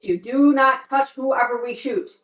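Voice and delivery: usual speaking voice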